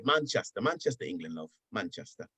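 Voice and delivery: casual Manchester claim in put-on British; British accent. Answering the woman where he's from, British accent. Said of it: "I'm talking like this".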